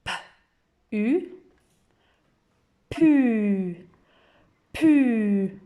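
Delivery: pu said loud and clear; teacher modelling pu; blending P with U. Shown as a demonstration. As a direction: join P and U into pu. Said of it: "à vous de réfléchir".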